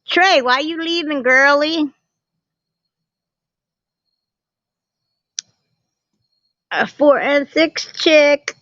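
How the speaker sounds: excited greeting. Real, not acted. Greeting chat viewer Trey.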